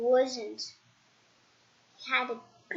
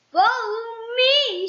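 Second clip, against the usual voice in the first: slow, higher, louder invitation; slow cheerful command; 8 semitones higher, 6 dB louder than usual. Acted. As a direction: call him along kindly but firmly. Mister Happy leads again.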